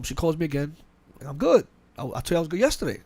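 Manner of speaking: ordinary delivery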